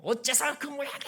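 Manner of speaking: hammed-up high scolding; imitated angry outburst. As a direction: act out a fed-up parent snapping at family. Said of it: "입이 확 열리면서".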